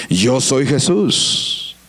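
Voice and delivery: slow, very low divine voice; grave, drawn-out declaration; Jesus answering solemnly. Acted. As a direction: answer deep and unhurried, with authority. Preacher voices Jesus answering Saul.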